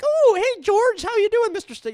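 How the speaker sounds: high, loud, gushing starstruck greeting; mock a goofy starstruck fan meeting his idol; giddy fanboy. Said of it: "I can't be all giddy and be like".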